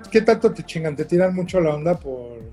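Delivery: ordinary delivery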